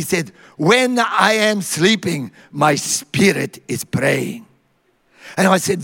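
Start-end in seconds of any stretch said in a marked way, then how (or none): 0.6-4.5 put-on slow, measured Maltese accent. Maltese friend excuses all his sleeping, slow imitated Maltese accent. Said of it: "that's my Maltese accent".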